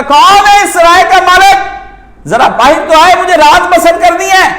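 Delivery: high, ringing fakir's shout; loud call. Retold or imitated. Fakir demanding a night's lodging from the palace owner, loud high call. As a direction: project it like a mendicant's cry. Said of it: "بلند آواز سے آ کے اس نے آواز دی".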